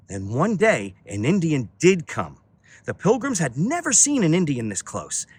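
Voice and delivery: ordinary delivery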